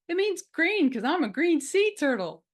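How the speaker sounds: high, loud kiddie voice; proud little turtle. Acted. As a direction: announce it like a proud small child. Little turtle explains her name.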